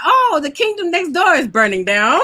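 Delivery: put-on a light, laughing English accent. Host playfully voicing a queen in her tower, light English accent. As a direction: say it like a prim English royal sipping tea. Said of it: "I don't know why I have an English accent".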